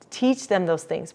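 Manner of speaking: ordinary talk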